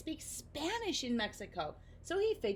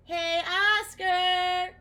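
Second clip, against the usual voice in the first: slow, high, loud call; shouted call; 10 semitones higher, 12 dB louder, much slower than usual. Acted. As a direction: Nibble shouting to Oscar from the closet. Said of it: "Nibble shouted".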